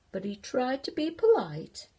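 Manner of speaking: ordinary delivery, nothing marked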